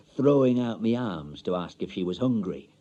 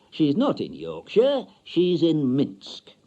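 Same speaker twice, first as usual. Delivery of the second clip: higher, louder officious salesman voice; pompous bureau man; 5 semitones higher, 5 dB louder, slower than usual. Acted. Marriage bureau man telling Mister Stanley, officious and loud. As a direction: lay on the formal jargon.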